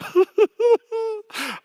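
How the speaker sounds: high-pitched burst of laughter; high giggle. Real, not acted. Laughing after the technologist's joke, high giggle.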